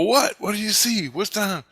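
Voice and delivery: retelling his own jumpy panic; alarmed questions. Retold or imitated. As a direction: fire the questions up high and anxious. Alarmed asking friend in tunnel.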